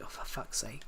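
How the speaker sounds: low, very soft exasperated aside; quiet mock exasperation; muttered exasperation. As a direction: mutter it wearily under your breath, retold. Exasperated reaction to friend's trolling.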